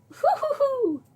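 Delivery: high hooting granny laugh; a sweet little old lady titter; old lady chuckle. Acted. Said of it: "do an old lady voice".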